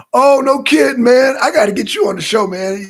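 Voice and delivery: high, loud burst of excitement; loud, excited reaction. Real, not acted. Streamer inviting a viewer on the show, loud and excited. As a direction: exclaim it loudly, racing into the invitation. Amazed reply to viewer's comment.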